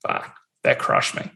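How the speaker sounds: soft, breathy rueful confession; a quiet admission of being crushed; hushed. Real, not acted. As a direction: breathe it out, low and slow. Recalling painful time.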